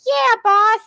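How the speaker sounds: loud, very high mock voice; high, exaggerated put-on voice; impression of another woman. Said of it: "the other one's like".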